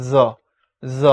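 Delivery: জ said as "zo", each one separate; buzzed z. Shown as a demonstration. Teacher repeating the basic sound, জ as z. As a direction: make জ a buzzing z, no press. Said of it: "এই যে জটার মূল উচ্চারণ".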